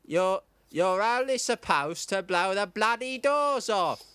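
slow, measured Cockney film star; deliberate, drawling Cockney impersonation; Michael Caine. Acted. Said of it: "impression of him".

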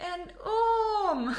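high, loud drawn-out yell; mock panicked cry. Family laughs about the snake warning, mock panicked yell. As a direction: wail it as a hammed-up alarm. Re-enacts the family's running joke.

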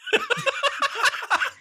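tension-breaking burst of laughter; high-pitched laugh. Real, not acted. Table breaks tension after the scene.

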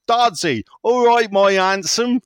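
loud, high West Country greeting; West Country accent. Retold or imitated. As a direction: call out like a cheery West Country local. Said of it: "continual abuse of [name]'s accents".

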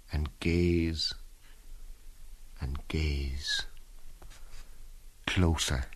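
slow, dropping to a hush; fading hush. Acted. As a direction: let it fade out. Stunned staring, voice fading.

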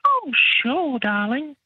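very high falsetto sweetness; mock agreeable. Chef giving in to the duty manager, mock falsetto compliance. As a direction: put on a squeaky, obliging voice. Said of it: "And I was like".

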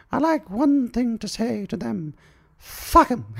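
high, grand royal speech voice; high queenly public address, hammed up; mock Queen's address. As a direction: address the nation as the Queen, then swear. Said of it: "when she made her public address".

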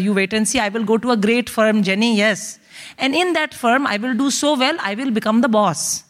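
put-on thick Indian accent, righteous and emphatic; vengeful Indian mother. Mother dictating reply to Jenny, vengeful.

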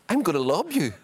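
high, loud goading call; sing-out trash talk; retold taunt.

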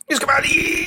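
high, strained, heaving; dad groaning as he pulls on trunks; straining grunt. Acted. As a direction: squeeze the words out while tugging on tight trunks. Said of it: "proster og stønner".

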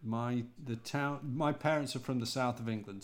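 normal talking voice

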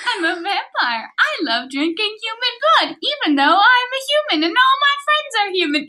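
high silly mocking voice; mock the vampire in a high voice.

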